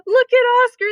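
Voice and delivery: loud taunting dogs; mocking cry. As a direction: shout it out to taunt him. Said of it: "they cried".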